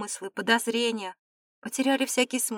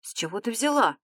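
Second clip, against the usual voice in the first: a touch of surprise in the question; surprised. A narrator's reading. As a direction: ask it mildly taken aback. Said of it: "удивлённо замер и спросил". (слегка удивлённо)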